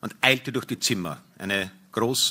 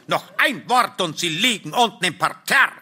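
higher-pitched indignant warning; angry threat; 6 semitones higher than usual. Acted. Husband threatening to throw the stranger down, angry raised voice. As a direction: warn him off heatedly.

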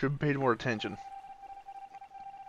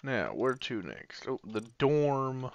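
ordinary talk, nothing marked

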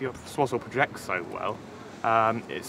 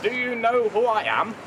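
Mister Punch voice; 9 semitones higher, 5 dB louder than usual. Acted. Punch puppet speaking.

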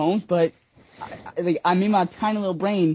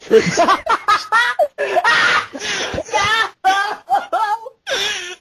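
loud laughing fit; 11 semitones higher, 5 dB louder than usual. Real, not acted. Cracks up at the joke.

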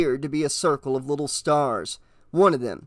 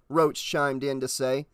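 ordinary delivery

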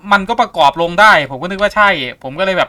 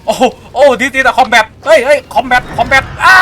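slow, drawn-out, loud and higher cries; excited alarm; 8 semitones higher, 8 dB louder, much slower than usual. Real, not acted. Gamer caught off guard by a ghost combat, loud drawn-out alarm. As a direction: stretch out the panic as the fight starts.

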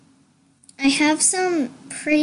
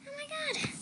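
squeal; 14 semitones higher, 14 dB quieter than usual. Real, not acted.

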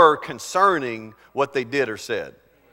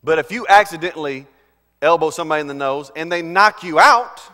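ordinary talk

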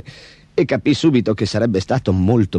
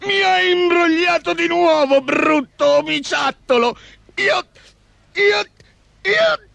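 very high, spluttering ogre fury; furious shriek; slower than usual. A narrator's reading. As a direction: shriek at the trickster until words fail. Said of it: "L'omaccione strillò infuriato". (strillo acuto da orco infuriato)

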